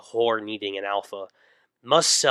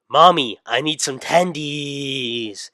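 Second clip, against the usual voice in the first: slow, drawn-out whine, higher; whiny manchild; 3 semitones higher, 4 dB louder, slower than usual. Acted. Son whines to his mom for tendies. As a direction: whine it like a spoiled boy.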